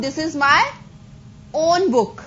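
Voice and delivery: pause, then own set apart firmly; stress on own. Shown as a demonstration. Teacher translating the emphatic example for class, stress on own. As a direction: hold back, then land hard on own. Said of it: "जोर डाल के बोल रहे हैं ना हम".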